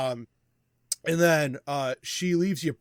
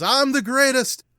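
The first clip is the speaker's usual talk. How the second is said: loud, high victory cry; boastful wrestler; 10 semitones higher, 8 dB louder than usual. Acted. Bex gloats to a booing crowd, loud high boast. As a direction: crow it to the whole arena. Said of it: "but Bex says she's".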